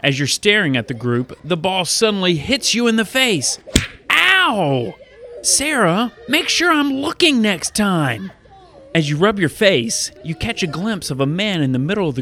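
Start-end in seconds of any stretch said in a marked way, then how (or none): 4.1-5.0 pained ow. Acted. Child hit in the face by a ball, pained yelp. 5.4-8.3 put-on high-pitched kid complaint; annoyed child. Child to the friend who threw the ball, annoyed.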